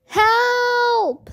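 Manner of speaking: long, high wail for help; howling. A narrator's reading. Two trapped girls to babysitter, howling. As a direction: howl the word, stretched out. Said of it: "they howled".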